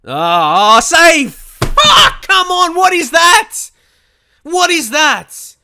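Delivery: high, loud, outraged yelling at the screen; strained cry of disbelief, slowing on the last words; furious shouting. Real, not acted. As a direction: explode at a blown chance. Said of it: "sorry for getting mad".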